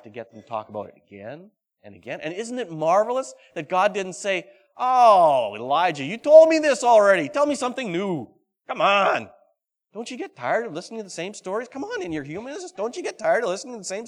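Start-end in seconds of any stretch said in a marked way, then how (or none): 4.8-9.3 mock exasperation. What God didn't say.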